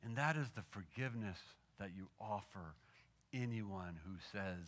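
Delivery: low, soft prayer with long pauses; hushed, unhurried pastoral prayer. Real, not acted. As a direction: pray quietly to the Lord, phrase by phrase. Leads a closing prayer.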